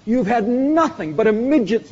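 booming preacher, lifting every phrase; preaching at full force. Acted. Preacher to his congregation, booming and lifted. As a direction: proclaim it to the whole congregation.

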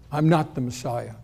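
low, soft, plain reply; simple, quiet answer. Retold or imitated. John answers the delegation, simple and quiet. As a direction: answer simply, without force. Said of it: "very simply looks back at them".

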